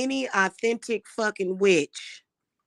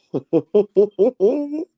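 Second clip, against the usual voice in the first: giggling; 3 semitones higher, 6 dB louder than usual. Real, not acted. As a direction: burst of tickled giggles. Laughs at own accent attempt.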